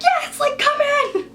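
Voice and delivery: high-pitched, urgent shout-like plea; desperate calling out. Retold or imitated. Trapped woman calling hotel security in, desperate relief. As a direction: call out high to be rescued.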